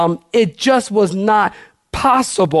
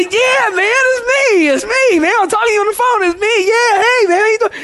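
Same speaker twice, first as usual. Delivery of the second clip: excited. Retold or imitated. Pastor reliving greeting his shocked host, very excited high voice. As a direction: gush it's me like you've finally found your friend. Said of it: "I'm excited".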